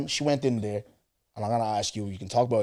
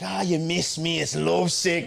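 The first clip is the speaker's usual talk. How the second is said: cocky teasing; 6 semitones higher, slower than usual. Retold or imitated. Teasing reply to her text.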